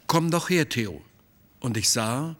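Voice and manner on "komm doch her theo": higher-pitched call, falling away on the name; calling over. A narrator's reading. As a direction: beckon someone over with a mild call. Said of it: "rief er". (leichtes Herbeirufen)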